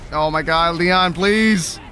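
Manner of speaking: usual speaking voice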